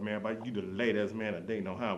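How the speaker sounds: ordinary talk, nothing marked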